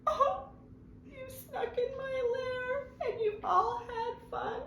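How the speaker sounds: high, soft mock-crying bear voice; sad bear. The bear tells his friends he missed the fun, mock crying. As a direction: whimper it like a hurt big baby. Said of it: "He's so sad".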